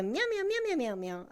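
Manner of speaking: high playful cat meows; cutesy nya speak. Shown as a demonstration. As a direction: meow like a cute kitten. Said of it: "you can make it a redeem".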